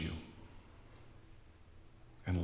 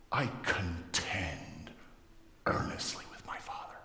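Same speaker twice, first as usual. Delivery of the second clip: intense whisper; 7 semitones lower, 8 dB quieter than usual. Acted. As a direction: draw it out low, then drop to whispering. Acted private prayer, dropping to whisper.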